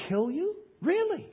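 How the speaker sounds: sarcastic high disbelief; incredulous. Acted. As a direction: scoff at the idea, pitch leaping up. Mocking the Israelites' doubt.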